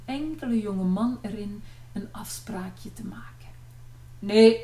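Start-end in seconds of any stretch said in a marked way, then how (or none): 4.2-4.6 loud, barked refusal from the father. A narrator's reading. Father turns suitors away from afar, barking. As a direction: bark it out from afar. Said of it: "blafte vader al van ver".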